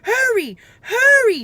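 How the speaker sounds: high, loud panicked scream. A narrator's reading. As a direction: scream at him to hurry. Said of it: "screamed Annie".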